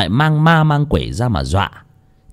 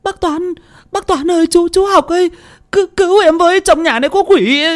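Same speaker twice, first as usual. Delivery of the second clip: much higher, frantic plea for help; panicked young woman; 4 dB louder than usual. Acted. Girl trapped inside begging the men for help. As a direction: beg for rescue in terror. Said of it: "tiếng cô Thơm hoảng hốt".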